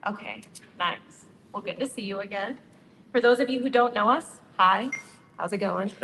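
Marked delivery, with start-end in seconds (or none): none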